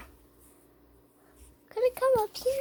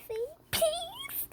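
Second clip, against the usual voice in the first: much higher, softer whimpering cry; demo crying; 12 semitones higher, 7 dB quieter than usual. Shown as a demonstration. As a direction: fake a wailing sob on peace. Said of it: "That's how you cry".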